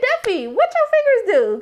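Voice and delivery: pitched high, teasing and scandalized; high scandalized tease. Retold or imitated. Recapper teasing Duffy about his fingers, high and scandalized. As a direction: squeal at him, delighted. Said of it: "I said".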